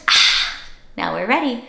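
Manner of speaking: ordinary delivery, nothing marked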